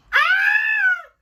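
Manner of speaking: loud, very high shriek; piercing put-on scream; mock panicked scream. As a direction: scream out in hammed-up alarm. Acting out panic at his advance.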